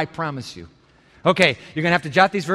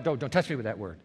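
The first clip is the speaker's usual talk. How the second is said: hushed, mock shrinking back; mock recoil; 9 semitones lower, 10 dB quieter, faster than usual. Preacher mimics a listener shrinking from the word, hushed mock recoil. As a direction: softly play someone flinching. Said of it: "I suppose you could say".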